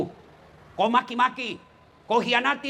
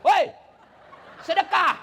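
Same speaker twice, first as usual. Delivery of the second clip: high, loud call to a departing husband; shouted demand; 10 semitones higher, 4 dB louder, slower than usual. Acted. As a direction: yell it out like a demanding wife. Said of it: "kau teriak". (teriakan menagih, nada tinggi)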